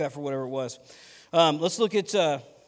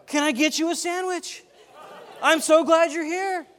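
put-on high, slow, sweet old lady voice with a laugh; 12 semitones higher, 7 dB louder than usual. Old lady offering firefighters a sandwich, sweet and high. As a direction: sound suddenly sweet and grandmotherly. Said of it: "she's like".

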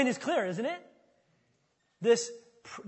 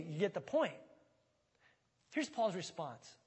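ordinary talk, nothing marked

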